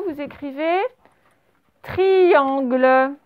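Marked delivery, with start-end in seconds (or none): 1.8-3.1 syllables drawn out, final one sounded; careful, stretched-out teacher's dictation; slow dictation. Shown as a demonstration. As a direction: dictate it slowly for a class. Dictating a word to pupils.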